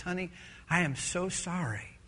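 low, very soft confession; remorseful apology. Acted. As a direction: apologise quietly, voice dropped. Husband confessing to his wife.

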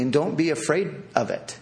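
normal talking voice

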